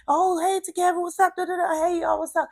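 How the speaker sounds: high imitated hey-y'all greeting; excited greeting. Kids climbing in the van, imitated excited greeting.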